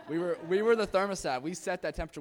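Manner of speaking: loud, triumphant testimony; rousing declaration. Real, not acted. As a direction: proclaim it loudly to the congregation.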